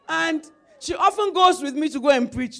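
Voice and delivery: loud, ringing pulpit voice; loud revival preaching; preaching at full force. Acted. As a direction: proclaim it to the congregation.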